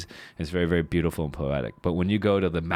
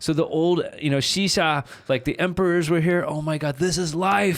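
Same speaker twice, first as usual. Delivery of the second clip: starry-eyed gushing; 10 semitones higher than usual. Retold or imitated. Tourist swooning over the old town, high awestruck gushing.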